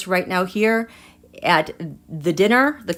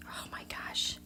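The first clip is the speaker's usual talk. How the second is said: hushed whisper; 17 dB quieter than usual. Real, not acted. Tarot reader whispering to viewers about an angry card. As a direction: whisper it like a secret you can barely say.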